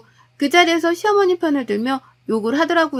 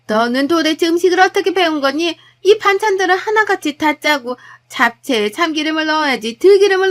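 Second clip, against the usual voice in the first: higher, louder, indignant mother-in-law; scolding mother-in-law; 4 semitones higher, 4 dB louder than usual. Acted. Mother-in-law faulting her daughter-in-law's birthday food, scolding. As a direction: berate her with rising exasperation.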